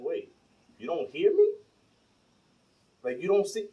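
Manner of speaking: mock-baffled, voice lifting; retold disbelief. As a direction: replay being baffled at getting ignored. Retells being ignored at the door.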